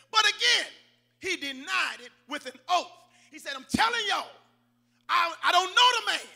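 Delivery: high, emphatic preacher's retelling; forceful scripture preaching. Real, not acted. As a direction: punch out Peter's denial for the congregation.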